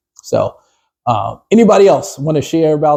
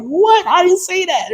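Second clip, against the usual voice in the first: high, shocked protest; high-pitched what, I didn't say that; disbelieving; slower than usual. Retold or imitated. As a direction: squeak out your innocence. Acted confused reaction to gossip.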